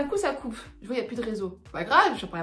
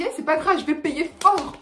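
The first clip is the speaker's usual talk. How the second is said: loud bravado; 6 semitones higher, 6 dB louder than usual. Retold or imitated. Bravado at the toll booth.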